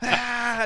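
high panicked cry; night terror cry. Retold or imitated. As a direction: wail from a nightmare. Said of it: "Night terrors".

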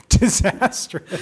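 drawn out slowly through a laugh. Real, not acted. Host picturing a freezer crashing downhill, laughing. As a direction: laugh the word out, amused.

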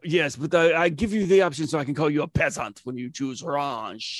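haughty French waiter; hammed-up French accent. Snooty waiter calling diner a peasant, French accent.

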